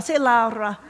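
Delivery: ordinary talk, nothing marked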